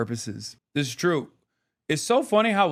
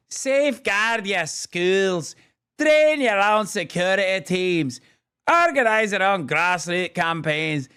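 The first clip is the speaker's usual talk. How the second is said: put-on Israeli accent. Streamer parodying Eve Fartlow's warning to New York, mock Israeli accent. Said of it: "homegrown Israeli accent".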